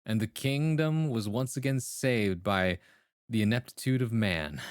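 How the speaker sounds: slow, grand storyteller voice over music; epic story narration. Acted. Streamer narrating a made-up peasant revolt, mock-epic storytelling. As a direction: spin the tale like a mock-epic bard.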